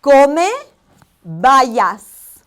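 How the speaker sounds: teacher modelling come bayas word by word; enunciated lesson model; slow Spanish drill. Shown as a demonstration. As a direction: come loud and rising, then bayas dropping to a breathy fall. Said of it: "We're gonna try that one more time".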